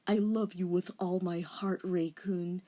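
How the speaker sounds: put-on soft boyfriend voice. Denny's line.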